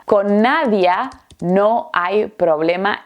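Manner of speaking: slow, CON NADIA, pause, NO HAY PROBLEMA; réponse articulée. Shown as a demonstration. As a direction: give the answer slowly, words set apart.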